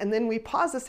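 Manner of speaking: ordinary delivery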